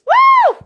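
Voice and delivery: loud, very high whoop of joy; excited whoop. Real, not acted. Instructor cheering before class starts, loud high whoop. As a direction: let out a big high cheer.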